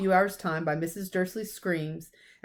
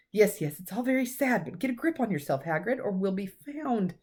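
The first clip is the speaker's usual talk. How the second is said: ordinary delivery, nothing marked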